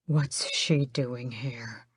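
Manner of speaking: slow, whispered hiss. A narrator's reading. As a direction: hiss it so she won't hear. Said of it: "he hisses".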